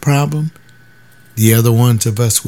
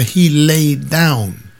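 slow "he laid down" again; "he laid down" said slowly in disbelief; 4 semitones higher, much slower than usual. Retold or imitated. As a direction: drag "he laid down" out, puzzled. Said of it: "I don't get the".

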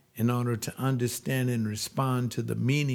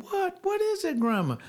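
worried question. Retold or imitated. Teen asks crying grandma.

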